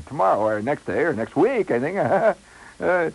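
loud, halting, hammed-up nervousness; flustered suitor. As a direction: stumble eagerly through it with nervous laughs. Nervous caller fumbles asking for date.

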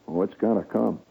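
usual speaking voice

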